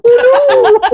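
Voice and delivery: very high, hammed-up matronly cry; Doubtfire-style falsetto. Host imagining greeting guests with pie on face, mock falsetto dismay. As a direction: play a flustered lady in falsetto. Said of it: "greet them with pie on my face and go".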